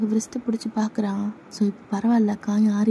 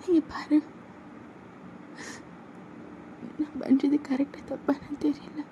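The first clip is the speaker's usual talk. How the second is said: high and soft, fading; a girlfriend confessing love through tears; soft high voice, trailing away; tender confession; 7 semitones higher, 6 dB quieter, slower than usual. Acted.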